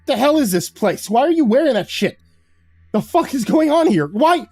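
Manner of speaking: high, loud outraged rant; angry snarl. A narrator's reading. New hire struggling in guard's grip, snarling. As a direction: snarl questions, climbing toward frantic. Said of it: "struggled in his iron grip and snarled".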